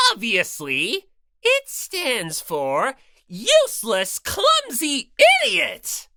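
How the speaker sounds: lightly voiced bossy bear mascot; Monokuma voice. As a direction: lecture her with smug cruelty. Monokuma snapping back.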